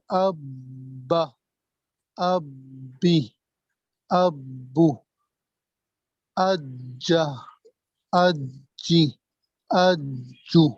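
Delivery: اب held, then ابو, اجا, اجی and اجو drawn out slowly with the doubled consonant; slow syllable-by-syllable shadda demo. Shown as a demonstration. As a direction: show the stop, then the vowel. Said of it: "دوسری مرتبہ حرکت دے کر".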